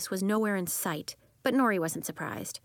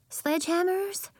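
much higher puzzled question; 11 semitones higher than usual. Acted. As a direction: ask in high surprise. Puzzled classmate asks.

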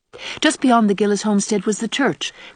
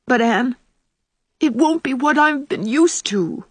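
higher-pitched, drawn-out lament; anxious protest; 6 semitones higher than usual. A narrator's reading. Ruby telling Anne heaven will be unfamiliar, anxious protest. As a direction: protest slowly in a raised, troubled pitch.

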